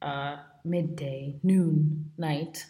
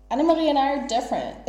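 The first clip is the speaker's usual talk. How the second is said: mock haughty quote; 7 semitones higher, 4 dB louder, faster than usual. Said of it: "I was like".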